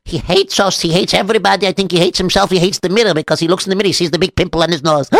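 ordinary delivery

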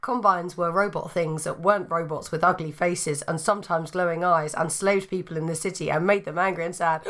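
ordinary delivery

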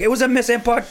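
very high, loud mock yell; hammed-up streamer shriek; screaming streamer. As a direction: imitate the gamer's screamed excuse. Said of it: "So that guy being like".

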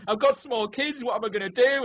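high-pitched imitation of crying panic; mock crying. Imitating Sal crying over his small kids, mock crying. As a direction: retell it as a hammed-up tearful plea. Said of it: "He starts crying".

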